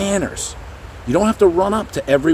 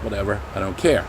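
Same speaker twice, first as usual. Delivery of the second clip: lightly voiced indifferent dog, softer and lower; low, quiet shrug of a line; unbothered dog; 5 semitones lower, 5 dB quieter, faster than usual. Well-mannered dog ignoring the fuss, soft and unbothered. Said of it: "he has manners. So he's like".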